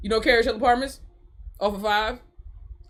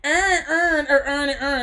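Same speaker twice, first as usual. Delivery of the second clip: higher-pitched "urn urn", mock Baltimore; imitated Baltimore accent; 5 semitones higher, slower than usual. Streamer mimicking a clip, mock Baltimore accent. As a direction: run every word into "urn", Baltimore style. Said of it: "they would be like".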